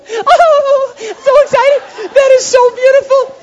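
very high, loud mock girly squeal; hammed-up falsetto shrieking, drawn out; squealing girls. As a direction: gush like a gaggle of excited girlfriends. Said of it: "five or six girls gather around and go".

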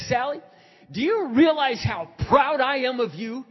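loud, high, proud declaration; emphatic affirmation. Retold or imitated. Pastor telling Sally how proud he is, emphatic. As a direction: proclaim it forcefully down the phone.

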